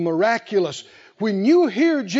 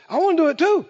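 bold, high-pitched eager plea; Peter; 7 semitones higher, faster than usual. Acted. Bold disciple asking Jesus to let him join, eager and high. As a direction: pipe up boldly, higher and keen to join in. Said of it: "And Peter is bold".

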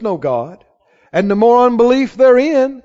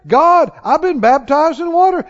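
raised, higher-pitched mock cry to God; retold scream; 6 semitones higher, 4 dB louder than usual. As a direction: cry out in mild imitation of a screaming man. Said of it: "He said he was screaming".

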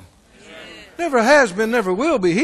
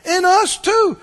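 higher, slow sarcastic jab; higher, slow sneering demand; mocking thief; 11 semitones higher, 5 dB louder, slower than usual. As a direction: taunt him from the next cross. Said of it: "Same tone".

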